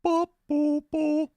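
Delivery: imitating the dad's squeak; Daddy Dearest voice. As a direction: darker squeaky bops. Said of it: "And Daddy's like".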